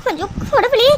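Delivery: high-pitched indignant outburst to herself; jealous disbelief. Acted. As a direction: react aghast at a rival's flirting. Jealous shock at open flirting. (பொறாமையும் அதிர்ச்சியும் கலந்த உயர்ந்த குரல்)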